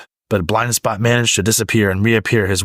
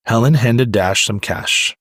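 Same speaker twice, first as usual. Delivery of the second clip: ordinary delivery, nothing marked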